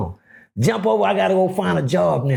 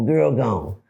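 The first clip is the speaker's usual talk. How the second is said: ordinary talk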